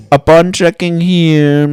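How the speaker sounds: mock scripted rep; prim call center read; phone rep voice. Imitated rep reading system.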